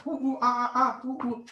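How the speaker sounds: high, jeering ape calls; mocking monkey hoots. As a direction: hoot like a monkey to humiliate them.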